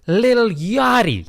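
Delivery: American accent. Shown as a demonstration. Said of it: "Had to say it in the American accent".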